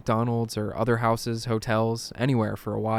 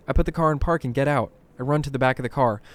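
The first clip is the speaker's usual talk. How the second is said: usual speaking voice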